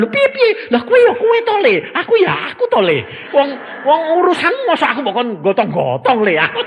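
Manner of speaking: high, loud, laughing brush-off; father waving off his son's worry; preacher mimicking himself. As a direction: pitch up and laugh him off. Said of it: "Aku bilang".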